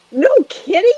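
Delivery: jolted by the news; retold disbelief. Psychic retelling her reaction to the client, high surprised. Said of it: "I go".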